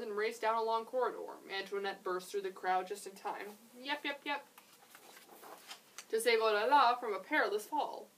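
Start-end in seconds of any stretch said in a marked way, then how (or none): 3.8-4.4 high yapping pup; puppy yaps. Acted. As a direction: yap like a small dog. Dog yapping in story.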